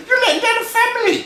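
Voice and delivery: very high, loud, fast squeal; outraged little brother. Acted. Little brother scolds timid Freddy, high squealing outrage. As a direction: squeal the telling-off.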